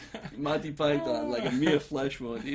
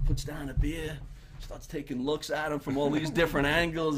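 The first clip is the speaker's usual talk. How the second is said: ordinary talk, nothing marked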